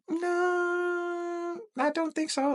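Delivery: very high, soft drawn-out no; high-pitched hesitant denial; imitated young lady. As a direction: mimic her in a high falsetto.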